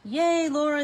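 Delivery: high, loud happy cheer; excited cheer. Real, not acted. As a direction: cheer for a friend. Cheering a viewer's comment.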